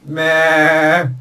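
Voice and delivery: bleating like a sheep; a cartoon sheep's meh. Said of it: "Interrupting sheep".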